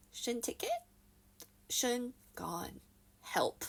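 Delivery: small, soft, halting voice; mock-timid, one bit at a time; meek helpless plea. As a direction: plead quietly with long pauses. Retells own humbled, helpless plea.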